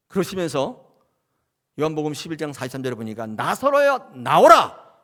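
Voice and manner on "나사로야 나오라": loud, high command to come out; commanding call. Acted. Jesus ordering Lazarus from the tomb, thundering command. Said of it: "명령해요".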